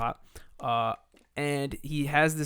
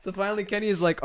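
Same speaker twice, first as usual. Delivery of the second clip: usual speaking voice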